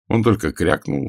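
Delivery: ordinary delivery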